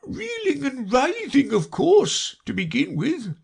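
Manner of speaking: high, drawn-out creature voice; Mock Turtle. A narrator's reading. Mock Turtle lists his lessons, slow high wail. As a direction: intone the subjects dolefully. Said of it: "the Mock Turtle replied".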